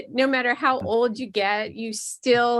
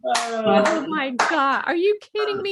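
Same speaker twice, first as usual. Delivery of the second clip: mock dismay; 3 semitones higher than usual. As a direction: act wounded and aghast. Reacting to the mom insult.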